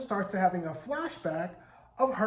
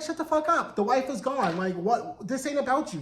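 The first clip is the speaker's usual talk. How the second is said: snappy retort; 6 semitones higher than usual. Retold or imitated. Wife snapping at June.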